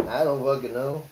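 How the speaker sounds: usual speaking voice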